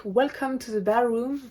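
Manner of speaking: the English title in a slightly put-on English accent; self-mocking English accent. Real, not acted. Manga vlogger reading the English title, in a mock English accent. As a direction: say Welcome to the Ballroom with a jokey English accent. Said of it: "avec mon superbe accent anglais".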